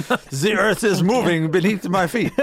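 lightly put-on French accent; mock French accent. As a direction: play a dramatic cartoon Frenchman. Joking French accents round table.

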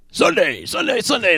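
high mock rally-promo chant; monster truck announcer. Host closing the Sunday plug, monster truck voice. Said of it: "that was my monster truck voice".

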